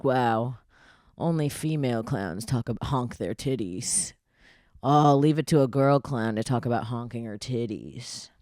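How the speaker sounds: a hint of a snide low online critic; lightly mocked commenter. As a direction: read the commenter with a sneer. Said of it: "I'd get comments like".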